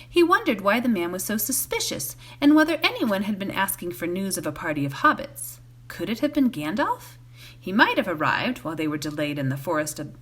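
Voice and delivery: usual speaking voice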